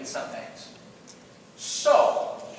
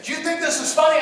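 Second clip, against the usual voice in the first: higher-pitched stern challenge; imitated yelling; 8 semitones higher than usual. Drill instructor challenging the cadet, mild mock yell. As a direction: scold without full volume. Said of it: "he starts yelling at me".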